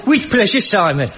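high, loud Birmingham accent, hammed up. Host asks for his Birmingham accent, hammed-up Brummie reply. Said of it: "give us your Birmingham accent again".

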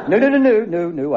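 quick high burst of no's; rapid-fire excited no's; excited denial. Acted. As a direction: wave the idea away, eager and loud. Bishop excitedly waving off.

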